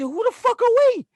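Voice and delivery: high, loud disbelieving outburst; incredulous yell. Retold or imitated. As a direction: throw it back at her in disbelief. Arguing with wife over renovation.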